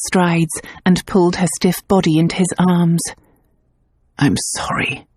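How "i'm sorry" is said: low breathy whisper into her hair; whispered apology. A narrator's reading. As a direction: whisper it close, remorseful. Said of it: "he said against her hair".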